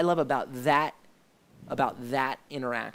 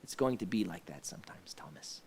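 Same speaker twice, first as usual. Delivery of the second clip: soft unvoiced whisper, lower; whispered line; 7 semitones lower, 10 dB quieter than usual. Yeshua gently answers Thomas, whispered. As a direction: hush it like a secret comfort.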